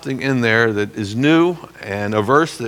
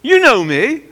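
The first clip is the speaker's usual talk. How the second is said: much higher, louder earnest plea; earnest self-defense; 4 dB louder than usual. Retold or imitated. Pastor defending himself before his congregation, high and pleading. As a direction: insist they know you, pleading hard. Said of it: "I said".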